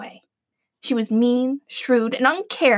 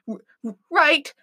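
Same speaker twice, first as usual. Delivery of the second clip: nervous stammer on right; stuttering; 2 semitones higher than usual. Acted. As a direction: stammer it out timidly. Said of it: "stuttered the Huntsman".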